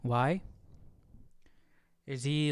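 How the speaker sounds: ordinary talk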